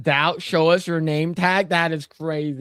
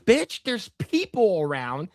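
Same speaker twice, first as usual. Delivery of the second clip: appalled scolding; 8 semitones higher than usual. Retold or imitated. Retelling his appalled reaction to the march.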